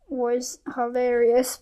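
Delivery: ordinary delivery, nothing marked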